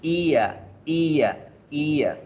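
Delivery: "ia" as a gliding "EE-uh", falling; diphthong. Shown as a demonstration. Teacher modelling the diphthong for learners, "ia" as "ee-uh". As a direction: glide from long ee into a schwa on "ia". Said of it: "se pronuncia el diptongo ia".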